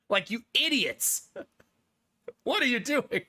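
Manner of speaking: lightly voiced exasperated AI; exasperated Skynet. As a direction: roll your eyes at us idiots. Skynet scolding humanity, acted.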